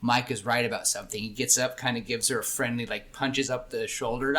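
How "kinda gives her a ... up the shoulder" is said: ordinary delivery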